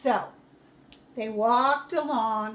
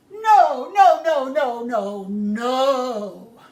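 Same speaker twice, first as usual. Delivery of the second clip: loud, furious no no no; angry refusal; 11 dB louder, much slower than usual. Acted. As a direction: shout the refusal. Pharaoh refuses angrily.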